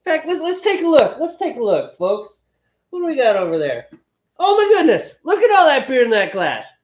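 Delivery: big, loud presenter voice rising to a high mock gasp; mock showman. Host mocking Steve's half-full glass, hammed-up astonishment. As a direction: play the astonished host for the crowd.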